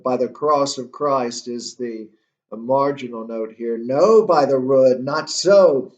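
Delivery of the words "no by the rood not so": loud, emphatic acted anger; angry. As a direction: throw back a forceful, angry denial. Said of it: "You can see the, the, the anger".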